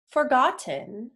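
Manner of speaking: ordinary delivery, nothing marked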